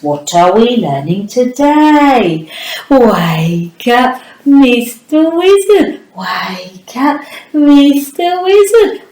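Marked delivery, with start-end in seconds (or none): 2.9-9.0 wake-up call. Acted. Calling the puppet to wake.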